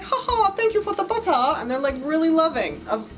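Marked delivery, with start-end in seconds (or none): 0.0-1.3 high, quick mock British accent with a laugh; laughing, hammed-up British thanks. Mocking grateful British bakers, high laughing British accent. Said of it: "they'll be like".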